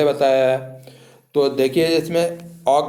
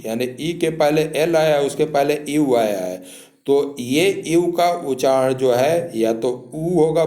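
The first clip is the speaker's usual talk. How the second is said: usual speaking voice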